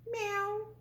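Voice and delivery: high cat meow; puppy meowing instead of barking; mock meow. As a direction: squeak out a little meow. Dog meows at vet.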